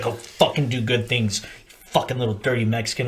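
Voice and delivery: low, soft instructor send-off; retold kill hat. Kill hat to new Marine, low gruff-love send-off. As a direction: drop the voice for a quiet order. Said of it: "he's like".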